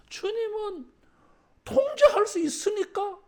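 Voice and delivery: 통제할 수 있으니까를 높고 크게 외치듯; 회중이 따라 하도록 크게 선창; 따라 하라고 외침. Shown as a demonstration. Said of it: "따라하겠습니다". (회중이 따라 하도록 크고 높게 외치는 선창)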